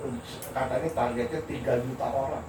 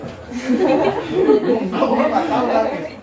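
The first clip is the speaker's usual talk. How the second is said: high, loud, laughing through the words; 10 semitones higher, 8 dB louder, much slower than usual. Real, not acted. Lecturer retelling his reply to a colleague, laughing. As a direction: break up laughing as you speak.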